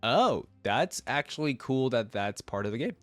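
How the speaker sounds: ordinary talk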